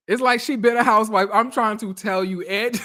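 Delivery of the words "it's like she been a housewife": ordinary talk, nothing marked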